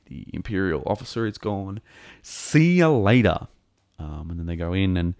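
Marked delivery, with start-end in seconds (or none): 2.2-3.5 loud, drawn-out gloating send-off; mock farewell. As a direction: stretch it out higher, waving off defeated foes. Mock farewell to ejected troopers.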